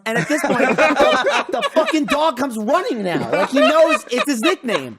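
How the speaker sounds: high, loud, laughing indignation; exasperated rant. Real, not acted. Owner complaining dog answers to nickname, exasperated laughing rant. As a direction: voice climbs in amused outrage.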